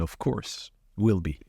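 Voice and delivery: usual speaking voice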